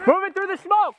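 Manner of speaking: loud battle callout; shouted callout. Real, not acted. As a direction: call it out over gunfire. Combat callout.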